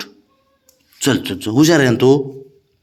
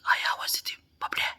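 whisper; 14 dB quieter than usual. Real, not acted. Father whispering to his son that mother is calling. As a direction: whisper it like a secret.

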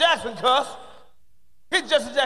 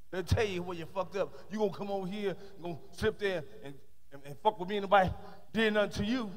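mock mad scolding; 3 semitones lower, 7 dB quieter than usual.

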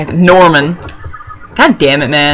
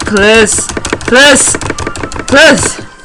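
short, higher pleas, rising in pitch; urgent pleading; 4 semitones higher, much slower than usual. Real, not acted. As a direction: beg in separate single words. Begging for the game to go well.

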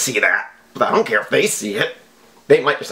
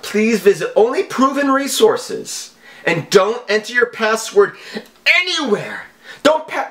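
mock scammer lecture; 5 semitones higher than usual. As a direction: lecture the victim like a smug hacker. Reading scam email in mock voice.

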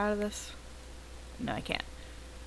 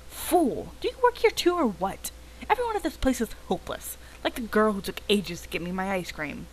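higher, scornful outburst at the staff; angry customer; 3 semitones higher than usual. Acted. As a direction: snap at everyone as hopeless. Soaked Carl snaps at them.

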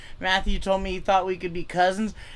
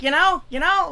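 put-on higher, louder Boston-accented "you know"; Bill Burr impression; 9 semitones higher, 5 dB louder than usual. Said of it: "like Bill Burr, he's like".

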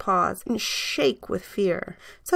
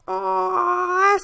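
put-on little boys cooing; 12 semitones higher than usual. A narrator's reading.

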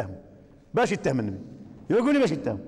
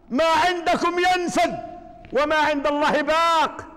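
raised, fervent preaching; preaching at full force; 5 semitones higher than usual. Acted. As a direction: drive the lines home to the congregation.

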